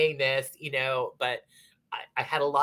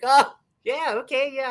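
high, louder oh-yeah of remembering; sudden recognition; 11 semitones higher, 8 dB louder than usual. Retold or imitated. She suddenly places the moonshine guy, in high surprised recognition. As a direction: catch up with a jolt, pitched up high.

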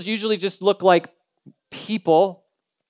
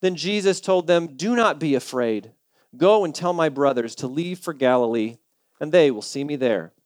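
scripture reading; 7 semitones lower, 5 dB quieter than usual. Acted. Pastor reading scripture to the church, lowered and gentle. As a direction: read the verse gently to the congregation.

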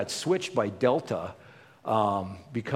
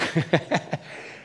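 breathy laugh; 5 semitones higher than usual. Real, not acted. Laughs at the story.

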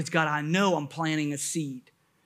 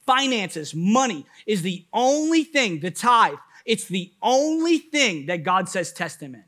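high, driving sermon delivery with hard emphasis; raised, insistent preacher's voice; full-force preaching; 8 semitones higher, 6 dB louder than usual. Real, not acted. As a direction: preach it urgently to the whole room.

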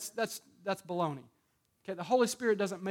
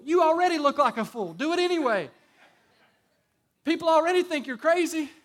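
loud teasing retort; 6 semitones higher, 6 dB louder than usual. Real, not acted. Preacher retorts to fearful believer.

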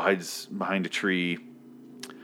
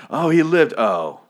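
louder, much higher mock gasp of surprise; 7 semitones higher, 9 dB louder, slower than usual. Imagined viewers reacting to survival, loud mock surprise. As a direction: play shocked he survived. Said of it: "it'd be funny".

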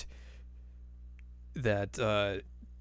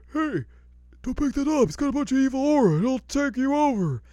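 higher, louder hammed-up old-man warning; fussy old man warning; 14 semitones higher, 5 dB louder than usual. Totosai warning Sesshomaru off in a high old voice. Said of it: "Toto's like".

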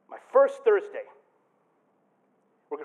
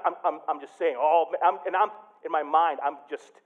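ordinary talk, nothing marked

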